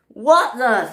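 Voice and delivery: high-pitched startled exclamation; screamed. Acted. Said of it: "screamed the passengers".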